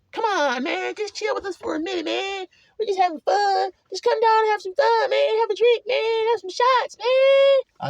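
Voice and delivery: mock the pushy sister egging him on to drink. Mocking the pushy partying sister.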